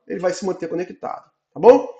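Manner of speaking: ordinary talk, nothing marked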